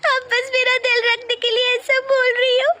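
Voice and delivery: high, loud sobbing self-blame; crying. Acted. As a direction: wail through tears, breaking into a cry. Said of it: "तू क्यों रो रही है". (रोते-रोते ज़ोर से गलती मानती बहू)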